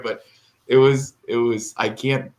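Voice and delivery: ordinary talk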